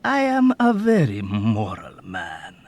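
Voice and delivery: old man mockingly assuring Nately; satiric seriousness. A narrator's reading. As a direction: pretend to be deeply sincere. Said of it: "assured him with satiric seriousness".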